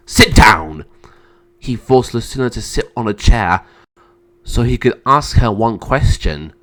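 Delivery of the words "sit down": loud, low order; angry command. Acted. Emperor forcing his sister to sit, angry command. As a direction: order it forcefully, dropping in pitch.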